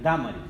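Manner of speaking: Dámaris stressed on the opening DÁ, pitch falling; stress on first A. Shown as a demonstration. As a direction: insist on DÁ-ma-ris, accent on the first syllable. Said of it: "le falta el acento".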